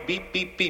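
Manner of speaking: high, soft electronic beeping; imitated alarm beeps. Hero reporting the red light to the secretary, imitated beeping. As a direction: beep like a little warning light.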